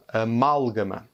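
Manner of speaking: amalgame with the weight on mal; amalgame, stressed syllable mal. Shown as a demonstration. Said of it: "accentuer la syllabe sur mal".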